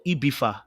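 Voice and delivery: Ibiza as "ee-BEE-tha", opening on EE; Spanish-style Ibiza. Shown as a demonstration. Host offers the other right form, Ibiza as ee-BEE-tha. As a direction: start Ibiza with EE, then TH. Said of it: "That's how they say it".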